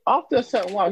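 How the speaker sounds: ordinary talk, nothing marked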